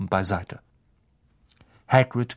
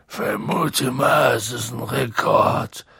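put-on slow, thick-tongued drunken voice; woozy, sluggish big-man drawl; slower than usual. A narrator's reading. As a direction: drag the words out as if tipsy. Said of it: "mit bräsiger Stimme".

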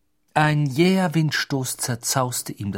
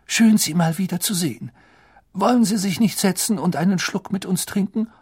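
higher, lively landlady; barmaid voice; 7 semitones higher than usual. Acted. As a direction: greet a regular warmly. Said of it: "die Stimme einer Frau".

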